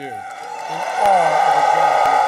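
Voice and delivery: loud, slow and deliberate; booming heartfelt repeat; emphatic repeat. Real, not acted. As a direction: lean hard on every word, slowly.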